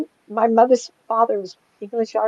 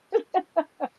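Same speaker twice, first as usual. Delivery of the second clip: high giggle; 11 semitones higher, 4 dB quieter than usual. Real, not acted. Laughing at a spirit showing up.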